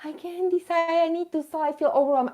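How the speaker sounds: a hint of overwhelmed complaining; whiny self-parody. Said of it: "this is what Inez is hearing".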